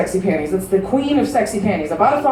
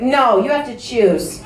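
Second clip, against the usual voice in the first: put-on slow, firm old grandma; lightly voiced stern grandma; grandmother voice; slower than usual. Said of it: "she went".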